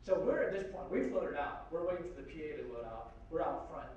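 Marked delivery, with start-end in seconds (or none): none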